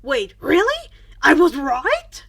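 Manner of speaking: can't believe he guessed right; stunned delight. A narrator's reading. Friend learns the truth.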